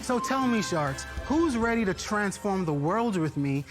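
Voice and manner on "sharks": ordinary talk, nothing marked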